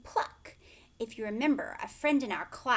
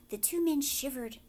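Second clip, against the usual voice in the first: ordinary delivery, nothing marked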